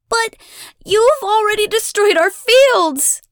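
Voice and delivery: high, loud outraged protest; shout. A narrator's reading. Abigail accuses the goddess, shouting. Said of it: "Abigail shouts".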